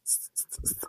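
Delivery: stuck on the s sound; real stammer block; stutter. Real, not acted. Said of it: "I am a girl who s- ... stammers".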